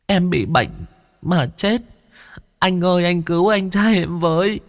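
put-on high, tearful child voice; a ghost child begging again; pleading child. A narrator's reading. (giọng trẻ con cao, van nài)

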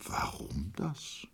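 soft, slow, breathless question; a monk whispering his puzzled question; wondering whisper. A narrator's reading. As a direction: murmur it in quiet wonder. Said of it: "Der Mönch wunderte sich".

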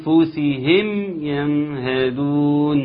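فلأنفسهم يمهدون slowly with both mims equal. Shown as a demonstration. Teacher testing a student's mims. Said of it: "حد يقول لي".

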